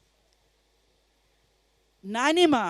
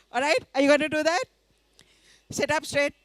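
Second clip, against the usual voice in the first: usual speaking voice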